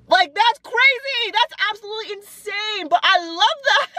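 high, giddy delight. Real, not acted. Thrilled after rapping the lyric herself. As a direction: squeal it out, thrilled.

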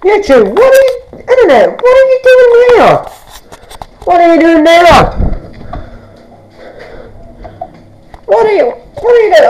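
very high put-on squeaky voice; playful high-pitched pestering; high squealed questioning. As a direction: squeal it at the internet in mock alarm. Mock-questioning YouTube in put-on squeal.

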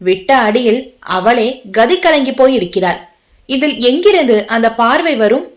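usual speaking voice

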